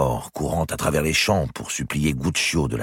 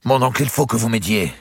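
higher, urgent cry for help; pleading outcry; 9 semitones higher, 5 dB louder than usual. A narrator's reading. Nephew begging his uncle for help, urgent outcry. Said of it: "s'écria Guccio".

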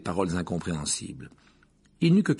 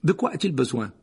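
brusque, slightly raised question; curt; 4 semitones higher, 4 dB louder than usual. A narrator's reading. Professor asking the nurse about treatment, curt. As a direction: ask it crisply, brooking no fuss. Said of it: "d'un ton cassant".

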